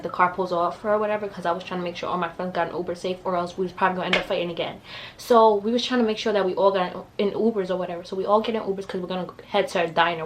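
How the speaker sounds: ordinary talk, nothing marked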